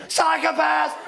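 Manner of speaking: high, drive-by yell; imitated yell. Guy on a bicycle yells at a walker, mock yell. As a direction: imitate a cyclist hurling it at a stranger. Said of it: "does the same thing".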